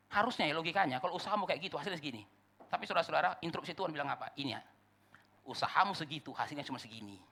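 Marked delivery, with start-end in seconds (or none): none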